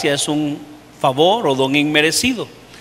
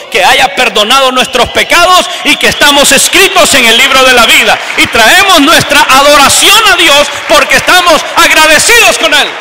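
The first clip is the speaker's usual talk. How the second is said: louder, climbing preacher's cry; full-force revival preaching; 6 semitones higher, 5 dB louder than usual. Acted. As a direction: lift the church to praise. Preaching crescendo.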